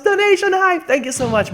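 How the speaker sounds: high, loud hype shout; hyped. Real, not acted. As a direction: pump up the donation. Celebrating donation.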